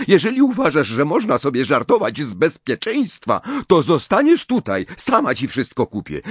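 high, loud and stern telling-off; scolding mother. Acted. Mother threatening to leave her son at home, furious. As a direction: lay down the law.

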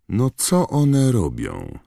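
high, slow drawn-out question; put-on Malfoy taunt; challenging question. A narrator's reading. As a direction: stretch it high, needling the teacher. Malfoy challenges Hagrid about the creatures.

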